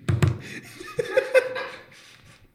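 loud breathy laughing fit; burst of laughter. Real, not acted. Game master cracking up at player's comeback, loud laugh. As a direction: break up laughing.

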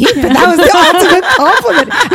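high, loud giddy laugh through words; host laughing with delight at being mistaken for Roxy. Real, not acted. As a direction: gush through laughter over the compliment.

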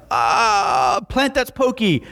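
high, cracking, near-crying stammer; panicked contestant. Retold or imitated. Game show contestant freezing under pressure, high panicked whimper. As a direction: choke on the answer under pressure. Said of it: "I'm like".

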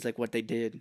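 normal talking voice

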